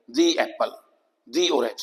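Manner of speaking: "the" said as dee before apple and orange. Shown as a demonstration. Teacher drilling "the" before vowel words, said as dee. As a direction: make "the" a clear dee. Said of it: "द की बजाय दी हो जाएगा".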